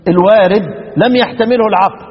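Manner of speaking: usual speaking voice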